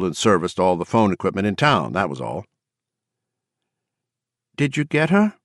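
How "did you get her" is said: timid, higher voice. A narrator's reading. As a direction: ask it shyly, voice raised. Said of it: "Paula asked timidly".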